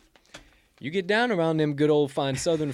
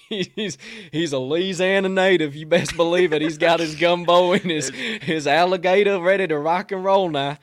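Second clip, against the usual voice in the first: hammed-up Cajun pride, louder; mock Louisiana accent; 5 semitones higher, 7 dB louder than usual. Host mocking the coach as a Louisiana native, in a hammed-up Cajun voice. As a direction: boast like a born Louisiana local.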